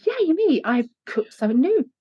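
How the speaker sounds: high, bright little self-cheer; yay me cheer. Pretend. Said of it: "have yay me moments".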